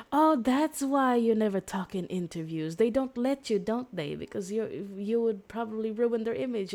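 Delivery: teasing taunt. Retold or imitated. Girl teases the silent celebrity, playful mock taunt. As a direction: rib him like a cheeky fan.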